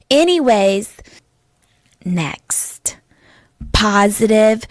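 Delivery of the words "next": breathy whispered next. Acted. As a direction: drop to a whisper for the switch. Whispered transition.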